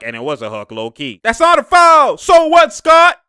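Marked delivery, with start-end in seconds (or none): 1.2-3.2 loud high yell, that's not a foul; outraged shout at the ref. Real, not acted. Fan yelling at the referee Scott, loud outraged shout. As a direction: protest the call at full voice.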